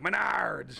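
punchy jingle-style shout of Menards!; Menards ad guy. Retold or imitated. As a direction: belt the store name like an ad. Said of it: "The Menards guy who would be like".

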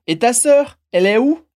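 "Et ta sœur, elle est où" pushed upward at "sœur" and again at "où"; rising question intonation. Shown as a demonstration. French teacher leading learners in repetition, rising intonation. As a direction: make "où" climb clearly at the end. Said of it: "repete comigo".

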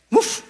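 short, high imitated bark; dog's woof. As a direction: give a quick high-pitched woof. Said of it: "says the dog".

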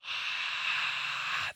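long, steady voiceless breath out; long exhale. Shown as a demonstration. Coach showing the red breath, long exhale. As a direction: blow a steady stream of air. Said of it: "That's my red".

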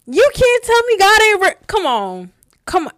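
high, loud testifying shout; fervent exclamation. Real, not acted. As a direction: cry it out with total conviction. Fervent testimony after answered prayer.

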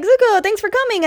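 quick, high-pitched acted welcome; excited greeting. Sokka welcomes Zuko on arrival, excited. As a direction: imitate greeting a friend, pitched well up.

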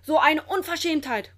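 loud, high-pitched outrage; indignant growling. A narrator's reading. Grandpa finds his dragon caged, indignant growling. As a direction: exclaim it crossly, with force. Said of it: "knurrt Olchi Opa".

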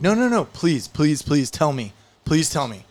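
high-pitched first plea, easing down; eager pleading. Acted. Woman at the bar begs him to tell, eager pleading. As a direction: beg playfully, drawing out the pleases.

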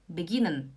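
"Beginnen" with a quick short i; short vowel. Shown as a demonstration. Teacher repeating the right form, short vowel. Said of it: "você fala beginnen".

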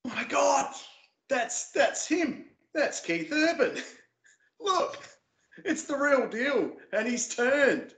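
very high, squeaky starstruck freak-out; hammed-up high-pitched fan excitement; excited inner voice. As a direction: act out a tiny giddy voice in your head. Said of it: "other little voice in my head over here going".

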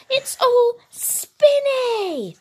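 put-on high, drawn-out child voice full of wonder; excited young character. A narrator's reading. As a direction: marvel in a high little voice, stretching the words. Excited Bing admiring the wand.

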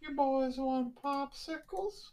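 high, slow, suggestive voice; creepy flirty lady. Pretend. Friend mocks the popsicle lady, high, slow and suggestive. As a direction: purr it out like a creepy seductress.

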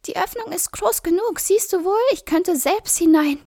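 very high, rising loud witch; impatient cackling crone. A narrator's reading. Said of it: "sagte die Hexe".

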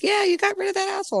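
Russians cheering. Retold or imitated. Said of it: "They're like".

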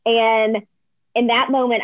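ordinary talk

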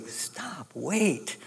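whispered, urgent stop; hushed stop. Retold or imitated. Leaders hush the weeping people, softly urgent. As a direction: hush the crowd softly but firmly. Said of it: "to stop them and say".

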